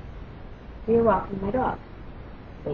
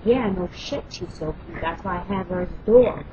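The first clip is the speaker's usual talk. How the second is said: ordinary talk, nothing marked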